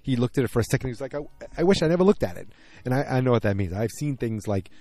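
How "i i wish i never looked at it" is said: lightly imitated neurotic New Yorker, high and rushed; fast, stammering mock regret; Ginsberg. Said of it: "very much neurotic New York".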